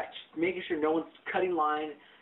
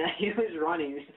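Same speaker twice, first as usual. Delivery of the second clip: normal talking voice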